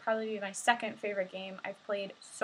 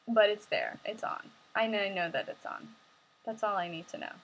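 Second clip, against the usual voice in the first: normal talking voice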